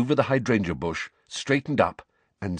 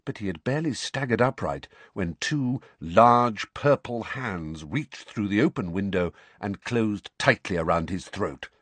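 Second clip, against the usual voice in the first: ordinary delivery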